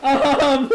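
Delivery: high helpless laughing fit; laughing too hard to talk; real burst of laughter. Real, not acted. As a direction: crack up at the wrong song. Song turns out wrong.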